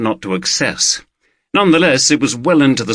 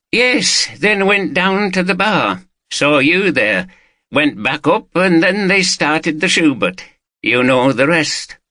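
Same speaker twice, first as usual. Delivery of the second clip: a hint of a higher, chattier local man; lightly voiced Watson; 6 semitones higher than usual. Watson telling the detective about the concert, lightly voiced. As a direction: pitch him a little up, easygoing.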